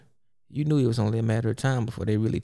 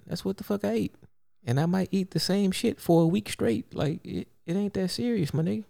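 much higher, incredulous exasperated rant; exasperated disbelief; 9 semitones higher than usual. Real, not acted. People keep asking what he ate, high exasperated disbelief. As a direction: squeal it out in disbelief that anyone cares.

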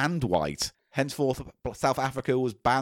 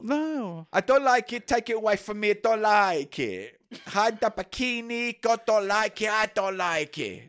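put-on high, petulant cod-accented pontiff; mock Pope; 9 semitones higher than usual. Pope refusing the bikini, high hammed-up whining. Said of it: "Your Holiness".

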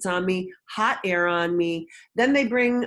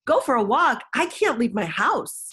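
higher, indignant echo of useless advice; mock incredulous; 5 semitones higher than usual. Overwhelmed mother answering the pediatrician's office, incredulous. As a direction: throw it back in exasperated disbelief.

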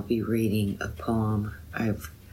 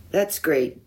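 ordinary talk, nothing marked

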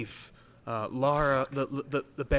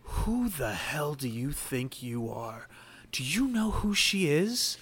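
hostile Dragonborn; 3 semitones higher, slower than usual. Acted. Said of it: "Bori steps in front of her and goes".

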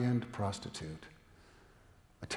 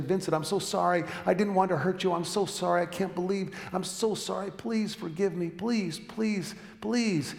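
high, insistent begging for forgiveness; pleading apology; 7 semitones higher, 3 dB louder than usual. Acted. Woman begging her lover's forgiveness, pleading. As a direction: plead higher and higher, repeating urgently. Acted tearful apology in sermon story.

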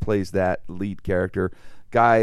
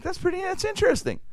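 much higher, feigned polite interest; mock polite surprise. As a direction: pretend to find it merely interesting. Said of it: "I'm like".

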